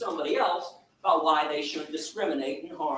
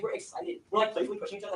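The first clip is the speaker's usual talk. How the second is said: usual speaking voice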